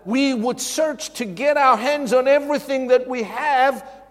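loud, high, driving sermon delivery; preaching at full force. Acted. Pastor urging the congregation to give, at full force. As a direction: preach it with mounting fire.

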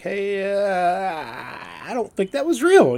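hammed-up doubt at hollow praise; thinking back at someone whose praise felt fake, mock disbelief; mock incredulous. Imagined reaction to fake praise.

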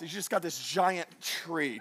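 normal talking voice

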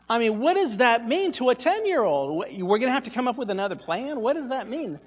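quick, incredulous echo of mom's words; baffled kid. Retold or imitated. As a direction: act out a child's bewilderment. Baffled child echoing mom's warning.

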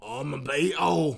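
slow, hammed-up Beatle impression. Showing off a best Beatle impression on request, mock Beatle voice. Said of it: "Give your best Beatle voice".

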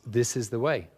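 level, no inflection, cold and firm; hard, cold determination. Shown as a demonstration. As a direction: deliver it like a Mandalorian vow. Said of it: "They say it with hard, cold determination".

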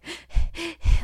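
quick, high, sharp gasps; loud, choked little gasps; short breaths. Retold or imitated. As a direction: imitate gasping short breaths while fighting tears. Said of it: "like, short breaths".

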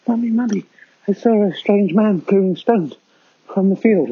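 put-on high, eager little kid; a child bursting to tell Mummy; excited child voice. Child telling mother about a stranger.

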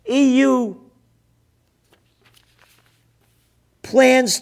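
very loud, high, drawn-out words; furious, outraged tirade; enraged shouting. Real, not acted. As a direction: roar each phrase slowly at them. Raging at warmongering officials and headlines.

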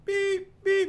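very high-pitched beeps; high electronic beep imitation; imitated warning beep. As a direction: mimic a car alert with piercing beeps. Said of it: "all I hear is beep".

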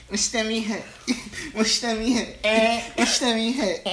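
laughing through the words. Real, not acted. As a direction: giggle as you say it. Laughing through repeated line.

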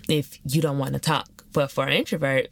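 normal talking voice

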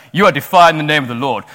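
loud, preachy mock rebuke; over-zealous pretend telling-off; mock accusation. Mimicking a confrontational believer.

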